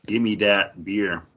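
normal talking voice

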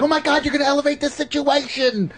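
put-on high, panicky girlfriend voice; mock-fretful squeal of worry; nervous girlfriend. Comic imitating his nervous passenger, high and panicky.

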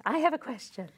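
a hint of the needy friend; mock texter. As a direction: toss the line off again. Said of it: "you love getting those messages".

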